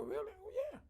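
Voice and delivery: surprised high voice. Retold or imitated. Patients surprised, retold high.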